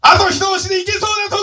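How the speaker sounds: high excited shout; shouted prompt. Real, not acted. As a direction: yell it out high. (高い声で叫ぶ)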